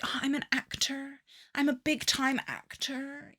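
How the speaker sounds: a hint of a smug show-off; mocking the ex. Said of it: "he was all like".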